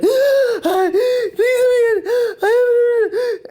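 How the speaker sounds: very high, slow, crying plea; demonstrated sobbing plea. Retold or imitated. Begging the security guard to let her in, sobbing. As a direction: wail it like you're begging through tears. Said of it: "crying like this".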